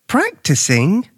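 high, slow, rising "Practicing?"; puzzled repeat. A narrator's reading. Luke repeats Alfred's answer back, questioning in puzzlement. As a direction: echo "Practicing?" as a bewildered question. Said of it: "Luke repeated".

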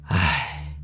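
long low sigh; student sighing before his story; defeated sigh. Acted. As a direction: let out a deep dejected sigh. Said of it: "范狂的头就垂得更低了".

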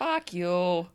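hammed-up Boston accent on a curse; mock New England accent. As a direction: swear like a caricature Bostonian. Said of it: "that's a British accent".

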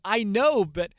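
put-on high, slow, excited kid; boy points out his young tree, in a high, slow child imitation; little boy voice. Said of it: "And he says".

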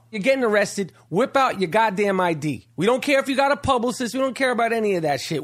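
ordinary talk, nothing marked

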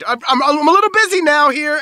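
high, loud harried protest; hammed-up busy surgeon's cry; flustered quote. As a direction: call it out, stammering and too busy. Acting out the bloody-hands line.

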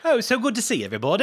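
fast, high, hammed-up posh British; bad English accent. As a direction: play a silly English toff. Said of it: "that's enough of the bad English accent".

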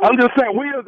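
loud, rattled stammer; flustered. Acted. Prank caller flustered by the furious car owner. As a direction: caught off guard, scrambling.

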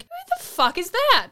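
high-pitched mock bafflement; incredulous outburst. Viewer baffled by unknown Marvel characters, incredulous. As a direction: blurt it out in exaggerated disbelief.

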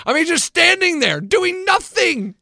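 high, drawn-out disbelief; incredulous exclamation. Real, not acted. Exasperated at neighbor's aimless standing.

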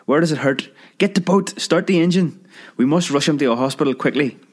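high, panicked pleading; a frightened fiancée begging for help; high panicked cries over a choking lover; frantic crying. Acted.

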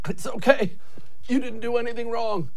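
high, strained voice reassuring through tears; hammed-up teary comfort, pitched well up; mock tearful reassurance. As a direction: play it like bravely comforting someone through pain. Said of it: "through the tears pouring down my face, telling him".

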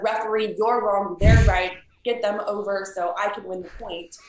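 a pushy player challenging the call; accusing challenge. Shown as a demonstration. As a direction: tell the ref he's wrong, loudly. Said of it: "Instead of".

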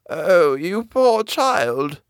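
put-on high, slow old crone voice; very old woman. A narrator's reading. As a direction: croak it out like an ancient woman. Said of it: "replied the old woman".

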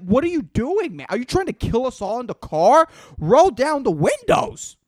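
mock shout at a vaping passenger; exasperated outburst. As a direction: yell at the guy stinking up the car. Mock yelling at vaper in car.

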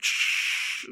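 unvoiced radio static shush; radio static hiss. Dispatcher making radio static noise. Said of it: "they're like".

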